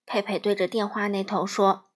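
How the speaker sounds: ordinary delivery, nothing marked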